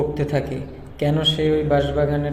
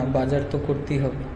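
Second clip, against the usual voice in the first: usual speaking voice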